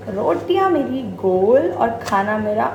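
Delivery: ordinary talk, nothing marked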